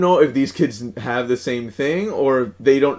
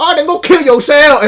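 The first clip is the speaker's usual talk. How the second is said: high, loud put-on Vietnamese accent with a laugh; mock Vietnamese accent; 13 semitones higher, 7 dB louder than usual. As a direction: shout it back in the accent, laughing. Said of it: "in that accent".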